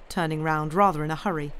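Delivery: ordinary delivery, nothing marked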